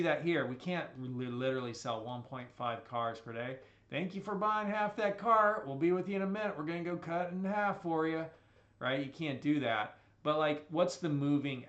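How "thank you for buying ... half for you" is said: high, loud, bright customer-service voice; mock salesman. Car salesman cheerfully telling a customer, hammed-up sales pitch. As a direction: play a chirpy car salesman thanking a buyer.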